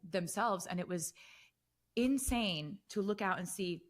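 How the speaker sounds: usual speaking voice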